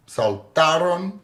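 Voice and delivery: saltaron slowly, sal-TA-ron; stress on middle syllable. Shown as a demonstration. Teacher demonstrating a word without accent mark, saltaron slowly as sal-TA-ron. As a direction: draw out the TA in saltaron. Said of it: "do not take the accent mark".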